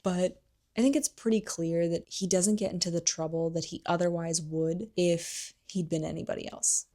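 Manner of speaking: normal talking voice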